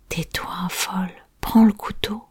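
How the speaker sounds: harsh whispered command; whispered order. Acted. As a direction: hiss the order to grab the knife. Girl orders the killing.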